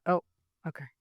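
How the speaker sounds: ordinary talk, nothing marked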